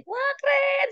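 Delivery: high-pitched cheer of praise; sorak memuji. Retold or imitated. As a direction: cheer the pupil on, pitch leaping up. Teacher cheers pupil's halting recitation. (seruan memuji dengan nada tinggi)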